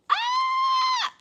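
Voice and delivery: very high scream after a gasp; gasp, then shriek with delight; piercing, overjoyed squeal; excited scream. Real, not acted. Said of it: "Sorry for all the screaming".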